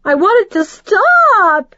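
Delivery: very high, loud, slow scream. Acted. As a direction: scream it out in despair. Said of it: "she screamed".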